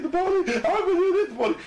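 very high, laughing mock whine; mock whimper. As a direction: whimper his name like a crybaby. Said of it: "He was crying, I mean, like a bitch".

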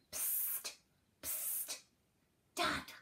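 secretive hushed hiss; hissed whisper. A narrator's reading. Girl secretly calling her dad at night, hissed. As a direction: get attention without being overheard. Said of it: "she hissed".

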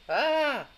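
high, drawn-out yelp of pretend fright; mock scream. Teacher acting out seeing a spider, mock scared scream.

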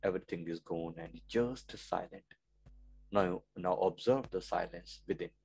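very soft, low meditation voice; hushed meditation. Acted. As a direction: lead them gently into silence, low and hushed.

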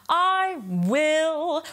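"will" with a strong pushed W; "will" with an accented W. Shown as a demonstration. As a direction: lean hard into the W of "will". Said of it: "really accent the W here".

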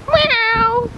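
high playful imitated meow; sing-song cat noise; mock cat meow. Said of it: "Is that what you're saying?".

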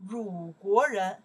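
鲁国人 said slowly and loudly, each syllable weighted; slow stressed answer. Shown as a demonstration. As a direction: spell out 鲁国人 slowly and loud for the class. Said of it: "I not say 中国".

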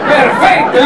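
ordinary talk

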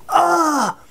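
sudden high "Ah!" of alarm; mock yelp; startled cry. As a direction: yelp as if hit by a falling tree. Acting out falling tree reaction.

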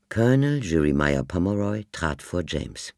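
ordinary delivery, nothing marked

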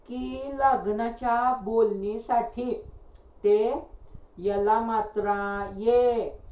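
slow, letter-by-letter reading aloud; laboured spelling-out of words; halting reading. Acted. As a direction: drag out each syllable while deciphering.

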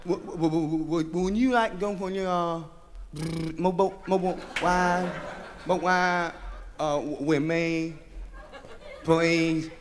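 low, halting, nervous stutter; stammering shy guy. Acted. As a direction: stammer it out, terrified. Bashful guy stammering invitation.

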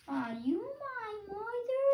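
very high and slow, pleading; baby bird voice. Acted. Baby bird asking the cow, high and slow. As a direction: stretch the question out like a tiny chick. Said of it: "my baby bird voice".

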